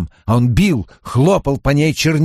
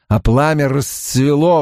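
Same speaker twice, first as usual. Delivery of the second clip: ordinary delivery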